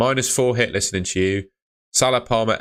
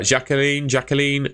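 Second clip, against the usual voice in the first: French-accented name, pitched higher; 6 semitones higher than usual. Shown as a demonstration. Streamer reading a chat member's name aloud, playful French accent. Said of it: "I did that in a French accent".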